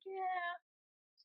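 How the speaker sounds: a high, airy throwaway "Yeah."; mock dismissive yeah. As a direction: brush it off like an unconcerned doctor would. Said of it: "they're like".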